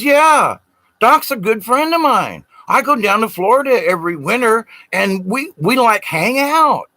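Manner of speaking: bursting to share that he knows Doc; excited friend. Retold or imitated.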